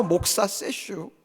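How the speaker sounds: slow, drawn-out Chungcheong 세슈; Chungcheong dialect. Retold or imitated. Preacher repeating the elder's words, slow Chungcheong drawl. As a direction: mimic the elder's dialect, slowly. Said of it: "충청도 말은 짧거든요".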